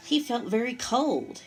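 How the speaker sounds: ordinary delivery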